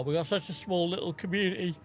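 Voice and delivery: words stretched through a yawn. Real, not acted. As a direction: say it mid-yawn, mouth wide. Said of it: "Sorry, I'm yawning".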